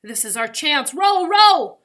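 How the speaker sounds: building to a high shout; rally the rowers loudly; urgent command rising to a yell; urging on. A narrator's reading.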